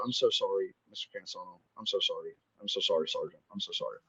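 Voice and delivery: low, very soft, defeated apology; subdued imitation of apologising while bawling; retold tearful apology. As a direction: apologise over and over, voice sinking away. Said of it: "I'm, like, bawling".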